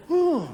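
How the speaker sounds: loud, high mock gasp; a child copying the grown-ups' dismay; copied shocked "oh". Child copies family's shocked reaction.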